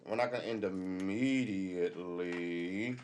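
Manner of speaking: slow, lingering drawl. Shown as a demonstration. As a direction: stretch it slowly, drawn out. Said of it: "slow drawn-out repeat".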